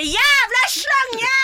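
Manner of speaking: high, loud retold shout of "Jævla slange"; mock yell. Shouting at Mario as she storms off, retold high loud mock yell. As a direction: yell the insult as you storm off. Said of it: "og bare".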